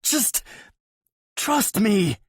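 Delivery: high, loud plea; begging. A narrator's reading. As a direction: beg him. Yuri begging.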